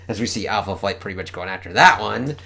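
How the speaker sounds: ordinary talk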